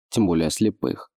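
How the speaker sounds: ordinary delivery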